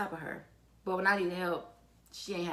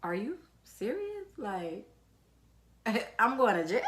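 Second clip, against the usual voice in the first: disbelief; 4 semitones higher than usual. Retold or imitated. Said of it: "I'm like".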